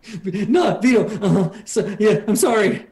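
high, stammering apology; frightened apology. Acted. Scared soldier apologises to captor.

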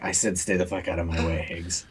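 low, hushed threat; menacing repeat. Acted. Game master as villain warning Higgins off, low and menacing. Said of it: "he just repeats himself".

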